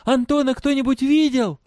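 high, loud calling voice; shouted question. A narrator's reading. Girl asking the prisoners about her father, shouting. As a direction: call it out to everyone, high and loud. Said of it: "крикнула она".

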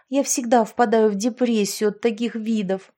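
ordinary delivery

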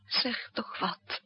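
slow tearful whisper. Acted. Lillet begging the narrator to speak, in tears.

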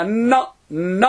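bare "ن" sound with no glottal onset; N without hamza. Shown as a demonstration. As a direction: say "ن" alone, starting straight on the n. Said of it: "ولكن بدون همزة".

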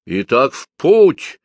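slow, grand knightly proclamation; theatrically pompous. Acted. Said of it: "театрально напыщенным тоном".

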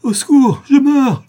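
very high, full-force scream; last desperate cry. A narrator's reading. Dying accomplice gathers strength for a last screamed plea. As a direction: throw all remaining strength into it. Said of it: "en rassemblant ses forces dans un dernier cri".

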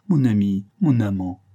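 supplication plus forte et passionnée; jette-lui ces mots comme un dernier appel; implorer. Acted. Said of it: "continuait à implorer". (Diana supplie son amant, plus fort et passionnée)

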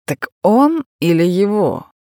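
slow and insistent; pressing. A narrator's reading. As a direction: press him for the answer. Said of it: "с нажимом уточнил Южин".

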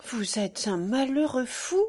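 ordinary delivery

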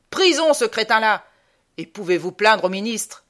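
loud, furious sentence from an officer; hammed-up colonel's rage; apoplectic colonel. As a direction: thunder the punishment at the recruit. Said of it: "Il devient bistre".

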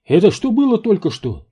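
very high, loud startled yelp; panicked squeal. A narrator's reading. Паунд reacts to the loud bangs, squealing. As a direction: squeal it in alarm. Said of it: "взвизгнул Паунд".

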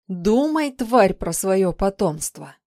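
loud, slow, venomous threat; angry. A narrator's reading. Woman threatening a stubborn captive, loud and angry. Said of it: "зло произнесла она".

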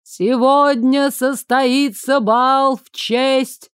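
slow, high, ringing announcement; town crier. A narrator's reading. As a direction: proclaim it to the whole market. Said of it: "голос глашатая".

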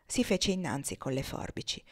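ordinary delivery

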